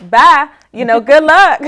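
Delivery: tossed-off loud "see ya" voice; breezy send-off. Retold or imitated. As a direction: imitate a cheery, brisk goodbye, breaking into a laugh. Voicing agency's cheerful send-off.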